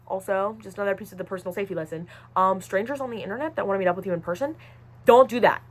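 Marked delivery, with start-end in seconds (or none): 5.0-5.6 firm warning. Acted. Warning viewers about meeting strangers.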